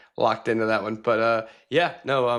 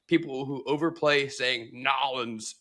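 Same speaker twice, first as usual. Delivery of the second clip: ordinary delivery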